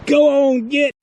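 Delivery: loud high "go on, get"; shooing shout. Real, not acted. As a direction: shoo it off loudly. Shooing again.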